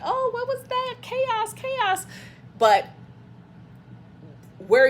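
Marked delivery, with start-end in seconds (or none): 0.0-2.1 high, panicky mock fright; hammed-up scared voice, chaos chaos; terrified. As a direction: squeal it like the cave is collapsing. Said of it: "you were terrified".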